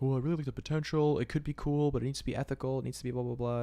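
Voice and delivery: lightly voiced mocking parrot. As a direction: rattle it off as a mock cliché. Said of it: "everyone says this. Everyone says the same thing".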